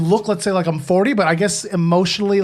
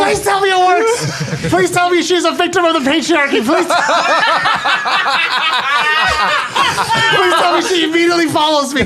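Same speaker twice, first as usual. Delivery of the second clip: higher, laughing plea to the dice; pleading hope; 7 semitones higher than usual. Real, not acted. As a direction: beg the roll to work, half laughing. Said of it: "'cause I have a deep voice".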